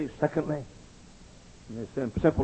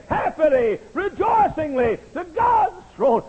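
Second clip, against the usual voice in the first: building from raised voice to high cry; fervent preaching; 8 semitones higher, 6 dB louder, slower than usual. Real, not acted. Preacher invites the congregation to God's throne, building to a fervent cry. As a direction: preach it climbing higher, phrase by phrase.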